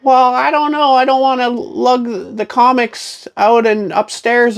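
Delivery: put-on high, reluctant old lady falsetto; put-on high-pitched granny objecting. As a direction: grumble like a cartoonish little old lady. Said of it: "I'm gonna do my old lady voice".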